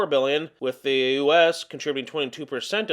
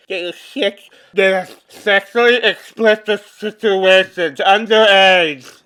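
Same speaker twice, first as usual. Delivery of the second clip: loud, slow, higher mocking whine; mocking whiny voice; 3 semitones higher, 10 dB louder, slower than usual. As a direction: mimic them in a whiny voice. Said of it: "That's how they all sound".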